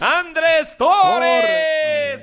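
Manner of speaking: high, loud, drawn-out batter introduction; stretched-out Spanish announcer call; announcer shout. Pretend. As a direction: holler the name like a stadium announcer.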